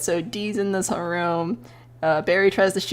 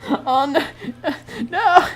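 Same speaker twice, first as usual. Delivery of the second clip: building panic; 7 semitones higher than usual. Retold or imitated. Panicking in game scene.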